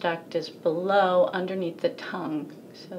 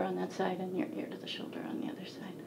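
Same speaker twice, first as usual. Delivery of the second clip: whisper; 10 dB quieter, faster than usual. Real, not acted. Doctor's quiet aside.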